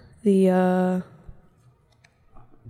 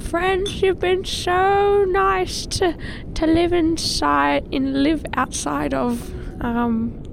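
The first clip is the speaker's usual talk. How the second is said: slow, high drawn-out whale voice; 4 semitones higher, slower than usual. Pretend. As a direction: speak slowly like a gentle whale. Voicing the whale's farewell.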